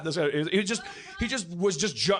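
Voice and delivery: ordinary talk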